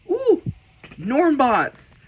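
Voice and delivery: high, loud ooh of delight; thrilled, high-pitched outburst; excited delight. Real, not acted. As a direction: exclaim with glee at a favorite. Delighted at next favorite drawing.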